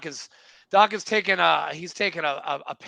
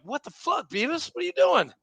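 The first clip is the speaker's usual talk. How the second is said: high-pitched incredulous question; retold astonishment; 9 semitones higher than usual. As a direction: act out disbelief, pitch leaping up. Retells spotting Beavis in disbelief.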